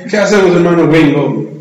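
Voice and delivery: hammed-up American accent in Spanish, low-pitched; mock gringo accent. Gringo soldier asking his comrade for help, mock American accent. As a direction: say it as a caricatured gringo. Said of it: "así hablan en inglés los gringos".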